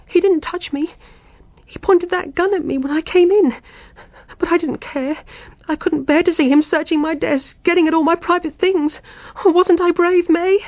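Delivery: a frightened woman near hysterics after the burglar; shaky hysteria. A narrator's reading. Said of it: "tearful and laughing with shaky hysteria".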